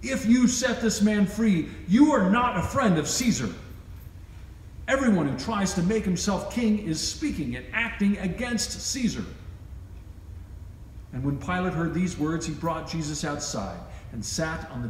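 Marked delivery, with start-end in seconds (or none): 0.0-9.2 louder and higher, accusing; crying out. Acted. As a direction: press Pilate with a raised, threatening voice. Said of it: "continually cried out against him".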